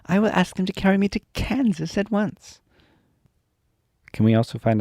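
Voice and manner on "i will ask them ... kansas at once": joyful. Acted. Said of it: "said the child joyfully".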